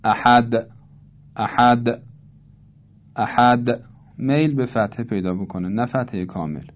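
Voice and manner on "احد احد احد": احد with the final د bouncing toward a; qalqala leaning to fatha. Shown as a demonstration. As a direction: say احد letting د rebound as a partial a. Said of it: "اینطور باید ایجاد کرد".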